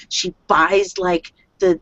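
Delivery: usual speaking voice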